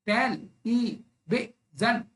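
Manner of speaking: "टेल ई बे जन" in four separate beats; syllable by syllable. Shown as a demonstration. Teacher counting four jaw drops, syllable by syllable. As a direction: gap after each syllable of "टेल ई बे जन". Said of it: "चार बार हो रहा है".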